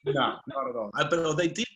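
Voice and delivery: usual speaking voice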